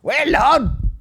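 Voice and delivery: high-pitched stage holler; mock tour greeting. Comic praising imagined Australian audience, high mock shout. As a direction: call it out high to the crowd. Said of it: "if I ever do an Australian tour".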